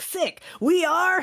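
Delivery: high, very loud gleeful yell; short, high-pitched excited shout; shouting into a pit. Acted. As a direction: bellow it triumphantly down a pit. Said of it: "Shouted it into the pit".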